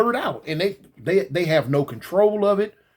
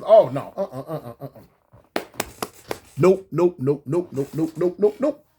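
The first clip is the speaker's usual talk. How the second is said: firm refusal; 3 semitones lower than usual. Retold or imitated. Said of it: "I said".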